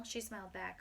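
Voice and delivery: ordinary delivery, nothing marked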